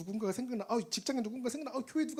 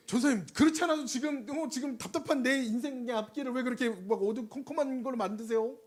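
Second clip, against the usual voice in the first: whiny complaint; 5 semitones higher, 3 dB louder than usual. Retold or imitated. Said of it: "acted complaint to pastor".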